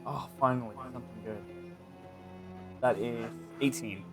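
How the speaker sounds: ordinary talk, nothing marked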